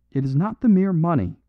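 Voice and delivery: the prophet protests, rising and loud; cried. A narrator's reading. Prophet defends himself to the priest, crying out loud. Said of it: "he cried".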